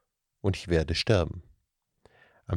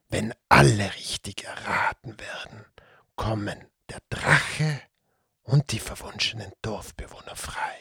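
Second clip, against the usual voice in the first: whispering serpent creature; 2 semitones higher than usual. Acted. The Datzelwurm confiding the curse to the children, hushed whisper. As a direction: tell the legend in a secretive whisper.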